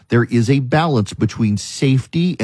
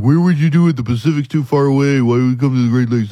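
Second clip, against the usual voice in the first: mocking doubters. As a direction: parody the naysayers' whiny questions. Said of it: "People are like".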